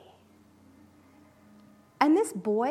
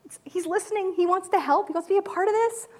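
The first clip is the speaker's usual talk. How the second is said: tender; 8 semitones higher than usual. Retold or imitated. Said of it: "maybe she was like".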